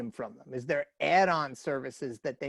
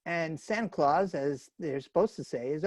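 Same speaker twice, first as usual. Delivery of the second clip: usual speaking voice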